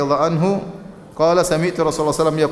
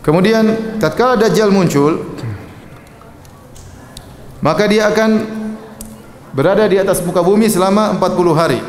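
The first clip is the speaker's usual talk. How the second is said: loud, slowed and raised proclamation; full-force preaching; 5 semitones higher, 3 dB louder than usual. Acted. Preacher warning the congregation about the Dajjal's forty days, loud and slowed. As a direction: proclaim it to the whole hall.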